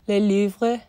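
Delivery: Le Louvre in a French accent, a self-conscious try. Shown as a demonstration. Vlogger trying a French accent on a book title. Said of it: "Let's try to say it with a French accent".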